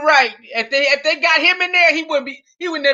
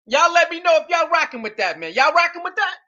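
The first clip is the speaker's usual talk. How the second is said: normal talking voice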